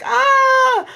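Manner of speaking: high drawn-out "Ah", then an eager outburst; изображает нетерпение. Retold or imitated. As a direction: imitate people desperate to get theirs. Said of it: "just like".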